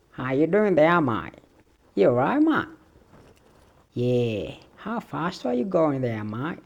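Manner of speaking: loud stab at Australian English; Australian accent. Acted. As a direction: put on a lively, badly mastered Aussie accent. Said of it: "what accent I've never been able to get".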